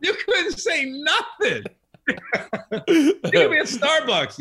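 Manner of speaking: high, slow disbelief; incredulous. Real, not acted. To his guest about Jordan's grudges, high slow disbelief. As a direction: stretch it out in mock amazement.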